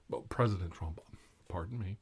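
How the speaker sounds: usual speaking voice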